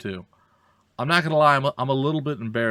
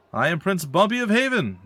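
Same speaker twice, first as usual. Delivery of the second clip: proud royal announcement; 9 semitones higher, 5 dB louder than usual. Acted. Repeats royal introduction.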